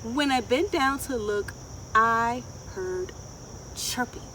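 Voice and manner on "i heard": ordinary delivery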